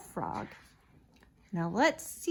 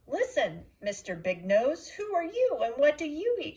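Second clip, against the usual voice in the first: loud, higher, fast frog shout; 8 semitones higher, 6 dB louder than usual. A narrator's reading. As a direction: yell it up at the big nose. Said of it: "frog calls out to nose".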